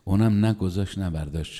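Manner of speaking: ordinary talk, nothing marked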